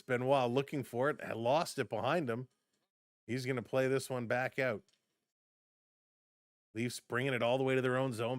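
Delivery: ordinary delivery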